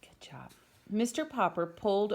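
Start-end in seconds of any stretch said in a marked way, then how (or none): 0.0-0.5 whispered praise. Real, not acted. Reader whispering praise to the listening child.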